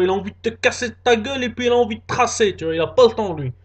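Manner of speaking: usual speaking voice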